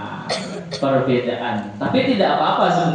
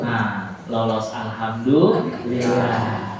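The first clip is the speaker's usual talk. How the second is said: usual speaking voice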